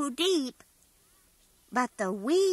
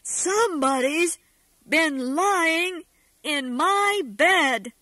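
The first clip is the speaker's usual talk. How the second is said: great big enormous voice; 4 dB louder than usual. Acted. Father Bear finding someone in his bed, booming big voice. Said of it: "said Father Bear in his great, big, enormous voice".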